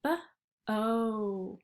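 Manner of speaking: B-O stretched, leaning on the O; sounding out. Shown as a demonstration. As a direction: isolate the middle vowel. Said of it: "What vowel do you hear in the middle".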